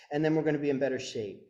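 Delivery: ordinary talk, nothing marked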